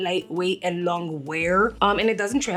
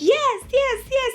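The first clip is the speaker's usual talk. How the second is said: excited yes. Retold or imitated. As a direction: squeal it out with delight. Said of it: "I was like".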